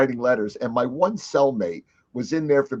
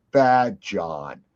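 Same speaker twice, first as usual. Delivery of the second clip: sedikit suara rendah dan mengancam; a hint of a sinister cellmate, pitched low; menacing alter ego; 6 semitones lower than usual. Cellmate reveals his bad personality.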